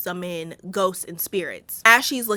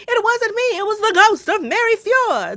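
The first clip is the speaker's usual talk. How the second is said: much higher, mock-innocent imitation of Claire; 12 semitones higher, 5 dB louder than usual. Imitating Claire pleading with her husband, higher mock-innocent voice. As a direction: squeak it out like a pleading wife. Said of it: "Claire was like".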